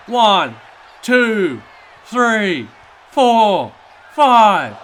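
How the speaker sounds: loud, high pin count; shouting count. Acted. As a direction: shout each number of the count.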